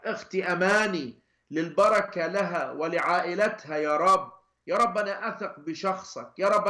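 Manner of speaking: earnest intercession, naming each person to God; steady praying cadence ending on يا رب; spoken prayer. Real, not acted. Leads intercession prayer aloud. (صلاة تشفعية بخشوع)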